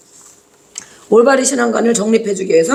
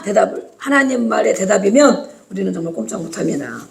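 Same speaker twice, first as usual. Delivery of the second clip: usual speaking voice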